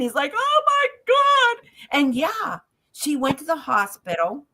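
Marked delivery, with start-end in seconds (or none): 0.3-1.7 high, drawn-out mock wail; imitated crying. Biker hugging the medium, imitated tearful wail. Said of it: "hugging me and crying".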